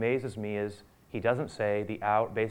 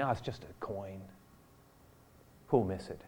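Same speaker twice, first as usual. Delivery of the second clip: quiet dismissive excuse; 3 semitones lower, 8 dB quieter than usual. Retold or imitated. Mock excuse for small sin.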